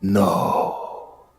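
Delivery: whispered no. Acted. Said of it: "replied the voice".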